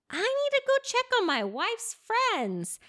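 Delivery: high, laughing, flustered excuse; a mock-panicked Yusupov bolting. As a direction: squeak out a nervous excuse.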